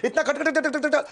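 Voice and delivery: high, rattled-off run of "कट"; rapid-fire flow. Retold or imitated. Viewers asking how he speaks in flow, rapid-fire mimicry. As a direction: rattle the syllables off without a break. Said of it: "पूरा flow में बोलते कैसे हो".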